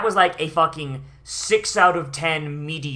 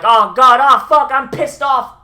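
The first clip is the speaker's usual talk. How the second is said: imitating Rack, a loud higher outburst; mock frustrated yell; 7 semitones higher, 11 dB louder than usual. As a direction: blow up loudly like a fed-up hothead. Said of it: "You can have Rack be like".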